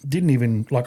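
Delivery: plain reading voice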